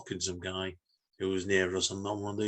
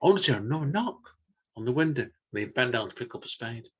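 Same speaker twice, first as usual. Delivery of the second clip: plain reading voice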